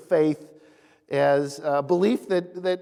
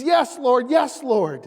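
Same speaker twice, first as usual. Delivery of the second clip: high, louder pleading; desperate plea; 6 semitones higher, 4 dB louder, slower than usual. Retold or imitated. Man dangling from the cliff pleading with the Lord, high and urgent. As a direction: beg the Lord from the cliff edge. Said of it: "the man says".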